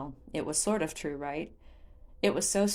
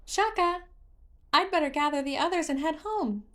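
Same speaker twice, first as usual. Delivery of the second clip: a chirpy little creature showing off; singsong Hestu voice; 10 semitones higher than usual. A narrator's reading. Hestu speaks, voiced character.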